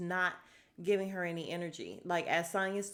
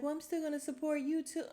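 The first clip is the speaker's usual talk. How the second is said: mock [name]; 9 semitones higher, faster than usual. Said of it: "And [name] was like".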